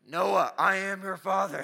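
loud, slow, booming villain voice; drawn-out mock-ominous declaration; Darth Vader. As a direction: boom it out as a prank. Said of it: "My Darth Vader voice".

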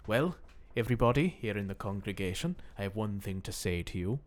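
put-on quiet Irish accent preacher. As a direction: address a congregation in an Irish accent. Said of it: "as an Irishman".

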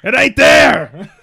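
loud slow shout. Acted. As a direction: bellow it at customer service. Yelling at customer service.

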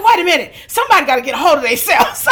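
half-yelling in giddy excitement; excited screaming. Retold or imitated. Telling her mother the news, screaming with joy. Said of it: "I started screaming".

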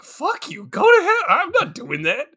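high-pitched indignant rebuff, dropping at the end; mock defiant refusal. Acting someone rejecting a push to open up, indignant. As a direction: play offended and refuse outright.

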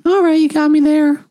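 high nasal-free squeaky voice; character voice. Acted. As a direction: pitch it way up in character.

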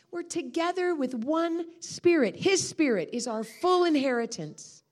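usual speaking voice

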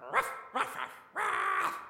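high yappy barks; high dog bark. Acted. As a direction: yap like a small dog.

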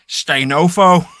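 put-on slow, loud Belfast accent; drawn-out Northern Irish sign-off. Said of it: "please end the show with a "Stay Nofo" in your Belfast accent".

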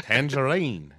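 a light New Zealand accent on "tangerine"; lightly done New Zealand "tangerine". Shown as a demonstration. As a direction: say "tangerine" the Kiwi way. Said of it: "saying tangerine gets you into a New Zealand accent".

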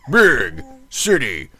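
slow, drawn-out booming announcer read; deep radio voice. Retold or imitated. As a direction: mimic a big natural radio announcer. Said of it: "that real deep radio voice".

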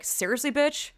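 riled-up imagined comeback; furious mock reply; angry retort. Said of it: "where it's like".

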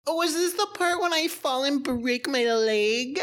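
high, slow frightened victim voice; mock-terrified, drawn out; horror heroine. Cohost hamming up the doomed horror heroine. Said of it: "heroine in a horror movie".

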